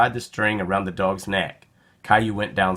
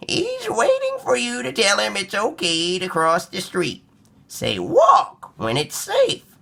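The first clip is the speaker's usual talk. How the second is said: high, patient explaining granny; grandma voice; 12 semitones higher than usual. A narrator's reading. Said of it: "Grandma explained".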